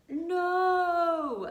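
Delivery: high, loud, drawn-out No; pleading no. Shown as a demonstration. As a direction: wail a long acted No. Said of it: "I want you to think".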